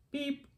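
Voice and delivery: high, soft little beep; a tiny toy-truck beep, way up high; truck's beep. Acted. Little truck greets the piggy with a high soft beep. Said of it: "said Blue".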